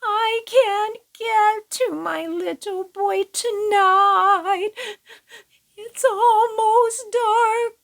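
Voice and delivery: high, teary plea breaking into a cry; crying old woman. Acted. Old woman cut off from her boy, crying. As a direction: sob it out, slowing at the end. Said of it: "And the old woman began to cry".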